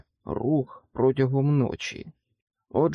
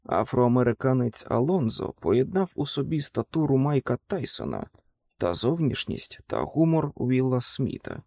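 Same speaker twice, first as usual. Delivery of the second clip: plain reading voice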